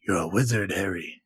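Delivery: low, gruff-wizard-giant impression; Hagrid. Tells Harry he is a wizard, Hagrid impression. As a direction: drop the voice low, falling away on the name.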